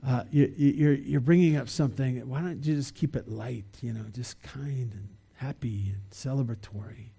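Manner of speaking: soft, understated mock reproach; mild scolding. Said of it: "some of us would have scolded Jesus".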